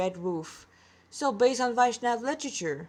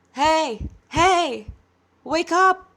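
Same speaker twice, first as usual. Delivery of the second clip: raised voice, higher and louder, calling; calling out to wake; 6 semitones higher, 7 dB louder, much slower than usual. Acted. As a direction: shake him awake from a distance with your voice. Sage wakes the sleeping boy.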